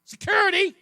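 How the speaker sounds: high, loud hammed-up cry for security; mock yell. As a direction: call out like a frightened coward.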